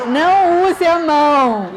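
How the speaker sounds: put-on child's firm correction. Child correcting her mother.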